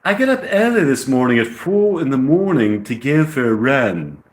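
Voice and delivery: clumsy put-on English accent. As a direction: try hard at sounding British. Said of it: "gotta work on that English accent".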